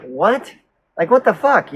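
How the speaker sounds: mock incredulous outburst, rising in pitch; can't-believe-it questioning; retold incredulity. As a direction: act out your own baffled reaction. Acts out his incredulous reaction to her.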